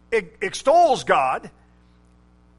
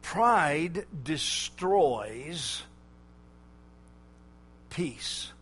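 slow solemn statement; 4 semitones lower, 5 dB quieter, much slower than usual. Real, not acted. Preacher slows for key point.

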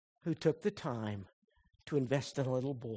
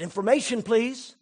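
put-on child on the phone; 7 semitones higher, 7 dB louder than usual. Boy calls operator sadly.

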